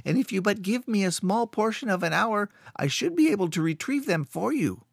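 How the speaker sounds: a scared little man talking fast to save himself; frightened caretaker. Acted. Frightened caretaker makes excuses.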